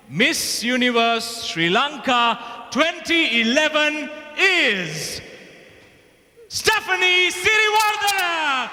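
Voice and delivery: high shouted winner reveal; excited shout. Real, not acted. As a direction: shout the name out in triumph. Announcing the pageant winner.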